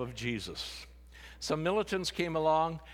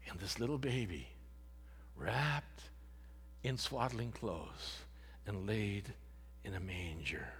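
softer and softer, ending unvoiced in a whisper; fading to a whisper; 4 semitones lower, 7 dB quieter than usual. Real, not acted. Preacher closing on the nativity, fading to a whisper. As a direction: let the manger line die to a hush.

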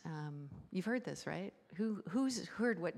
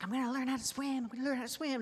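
imitating an eager child, higher and quick; higher-pitched, quick excited child impression; excited little kid; 7 semitones higher, faster than usual. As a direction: gush like a kid raring to go. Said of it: "he's all excited".

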